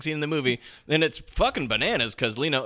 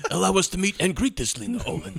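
Fat Tony voice; 5 semitones higher than usual. Retold or imitated. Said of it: "sound like Fat Tony now".

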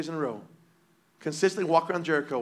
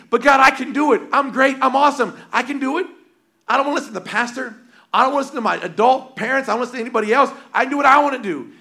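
loud, higher, proud self-will against God; stubborn defiance; 8 semitones higher, 8 dB louder, faster than usual. Retold or imitated. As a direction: boast like you know better than God. Voicing a defiant believer.

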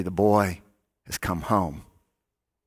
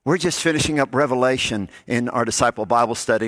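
ordinary talk

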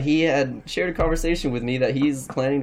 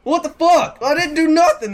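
imitated high, loud outraged yell; mock shock, shouted much higher and louder; outraged disbelief; 6 dB louder, slower than usual. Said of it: "And he's like".

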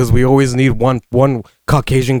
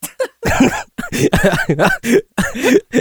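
squealing laugh; high-pitched laugh at the joke; high giggle. Real, not acted. Laughing at own eulogy.

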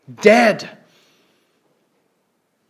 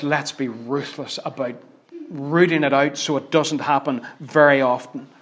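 ordinary delivery

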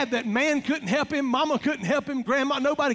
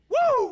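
high, loud whoop of delight; excited whoop; 6 dB louder than usual. Real, not acted. Preacher whooping to the congregation, loud and high. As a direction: whoop it up at the gross punchline. Preacher exclaims over Jonah vomited out.

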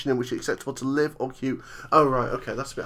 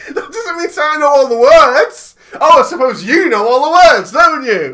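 mock indignation; 9 semitones higher, 14 dB louder than usual. Mock-indignant comeback, loud.

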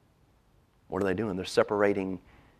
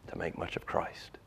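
whisper; 11 dB quieter than usual. Real, not acted. Whispered sermon point close.

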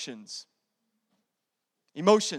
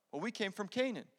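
much softer, meek answer; meek reply; 11 dB quieter than usual. Acted. Brothers answering Joseph's harsh question, meekly.

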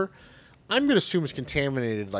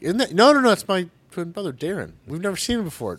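higher, louder put-on voice playing the actor; mock Damian Lewis excuse; 8 semitones higher, 7 dB louder than usual. Mocking the actor's bad accent, put-on sheepish voice. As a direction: ham up a sheepish actor denying it's him.

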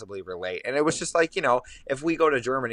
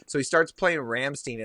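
ordinary talk, nothing marked

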